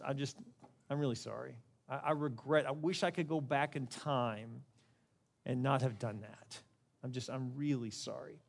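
very soft, low, halting regret; remorseful apology. Shown as a demonstration. Preacher modelling how to own it and express regret, hushed and remorseful. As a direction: own it quietly, with sincere sorrow. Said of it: "just own it and just say".

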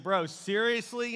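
mock exasperated wife. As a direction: drag it out in disbelief. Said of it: "She's like".

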